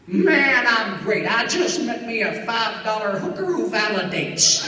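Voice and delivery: drawn-out cracking country voice; Pat Buttram. Retold or imitated. Old star answers without missing a beat, cracking drawl. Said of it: "Without missing a beat".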